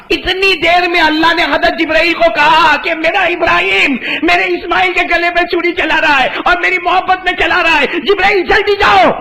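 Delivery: high, rising urgent call ending in a shout; urgent command. Acted. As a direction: hurry the angel on with a shout. God urgently sends Jibril.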